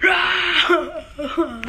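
high mock wail ending in a cry; hammed-up sobbing yelp. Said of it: "What's that crying noise?".

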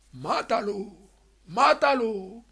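high, rising urgent kill order; father demanding they kill the baby; father's command. A narrator's reading. A father orders the newborn killed, high and urgent. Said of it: "y papá dijo".

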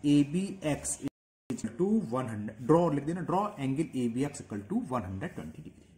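slow, deliberate dictation, word by word; teacher dictating a construction step. Shown as a demonstration. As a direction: read it out slowly so students can write. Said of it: "ऐसे लिख दो".

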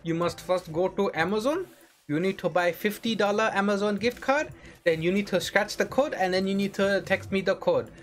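put-on sing-song Indian accent, phone-script patter; Indian call center. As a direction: play a scam caller with an Indian accent. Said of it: "an Indian call center".

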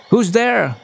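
high, carrying question from an owl; calling out. A narrator's reading. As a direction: call out to find who is there. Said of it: "called Olive".

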